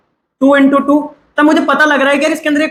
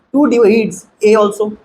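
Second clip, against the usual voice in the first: drawn out, each word slowly; teacher stating a rule slowly; slow, spelled-out statement; 3 semitones lower, much slower than usual. Shown as a demonstration. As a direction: lay out the statement slowly for the class. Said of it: "तो अपन ये भी बोल सकते हैं क्या".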